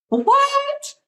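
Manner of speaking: high squeak of disbelief; can't believe the news, a high startled what; stunned surprise. Real, not acted. Hears she is published.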